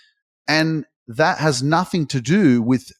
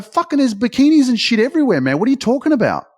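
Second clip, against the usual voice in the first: loud, higher, disbelieving reply; incredulous; 11 semitones higher, 4 dB louder, faster than usual. Retold or imitated. Telling a friend from New York how Dubai really is, in loud high disbelief. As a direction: scoff at the friend's silly question, much louder and higher.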